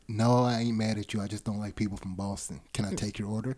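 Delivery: low, soft, deadpan waitress voice; retelling the waitress's dismissive reply, low and soft. Retold or imitated. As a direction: say the put-down low and flat-voiced, almost under the breath. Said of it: "repeats the waitress line".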